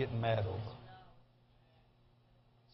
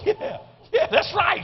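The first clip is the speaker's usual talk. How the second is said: laughing agreement; 6 dB louder than usual. Retold or imitated. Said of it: "He goes".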